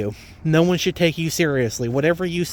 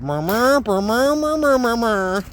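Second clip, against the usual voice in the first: high, meaningless murmur babble; muffled adult babble; 7 semitones higher than usual. Retold or imitated. Ranter tells viewers how pro-lifers should sound, high mock babble. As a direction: make their words pure noise, a high muffled mumble. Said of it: "just hear that".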